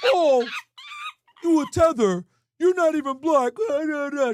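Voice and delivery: high, loud whiny taunt; mock critic voice. Streamer parodying a Twitter troll accusing him, high whiny mockery. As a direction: parody the hater in a nasal sneer.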